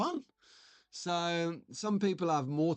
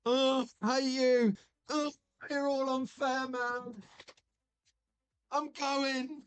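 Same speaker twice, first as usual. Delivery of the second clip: much higher squeaky chipmunk voice, hammed up; mock-hurt chipmunk whining at the chat; 9 semitones higher, slower than usual. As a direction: play the sulky chipmunk the chat teases him as. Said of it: "this chipmunk stuff".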